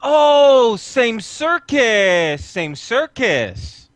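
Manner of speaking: loud high exclamation, then slow and drawn out; New Zoot character voice. Acted. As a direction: proclaim it slowly, starting big and high. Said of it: "and he'll say".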